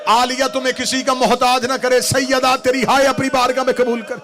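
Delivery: impassioned majlis cry building to a sung wail; full-force preaching. Acted. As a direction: lament at full force for the mourners.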